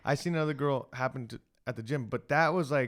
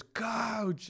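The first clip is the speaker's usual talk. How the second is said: retold scream; 9 semitones higher than usual. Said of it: "Like, he screamed".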